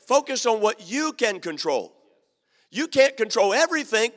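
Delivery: usual speaking voice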